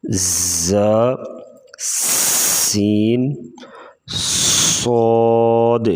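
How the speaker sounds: "ز، سین، صاد" each begun with a long whistled sibilant; whistling letters. Shown as a demonstration. Teacher lists the three whistle letters, each sibilant held long and firm. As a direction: draw out the hiss opening ز, سین and صاد. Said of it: "سی ٹی والے حروف جو ہیں وہ تین ہیں".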